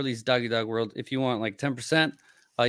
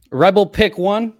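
announcing name; 8 semitones higher, 11 dB louder than usual. Real, not acted. Reading out a winner's name.

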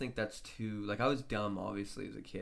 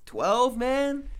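incredulous; 13 semitones higher, 10 dB louder than usual. Real, not acted. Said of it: "Twelve's that age".

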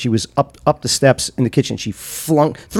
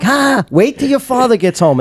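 mock threat; 10 semitones higher than usual. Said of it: "I was like".

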